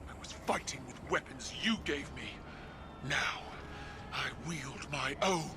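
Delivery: loud, defiant battle cry; defiant shout. Acted. Empress shouting defiance at her father mid-battle. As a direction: hurl it at him over the fight.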